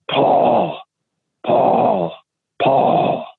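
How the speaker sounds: breathy, mostly voiceless eerie call; mysterious creature calling. Retold or imitated. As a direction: imitate an unseen thing calling a name. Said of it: "something was right outside his tent".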